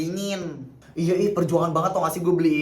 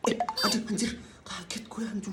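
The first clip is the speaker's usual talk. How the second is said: sudden high startled yelp; 5 semitones higher, 8 dB quieter than usual. Acted. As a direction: jump at a sudden fright. Startled by phone call. (kaget tiba-tiba, suara naik tinggi)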